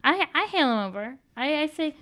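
ordinary delivery

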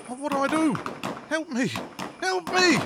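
high, desperate begging; panicked plea. Acted. Informer begging police for help, panicked. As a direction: beg for help, pitch climbing with each plea.

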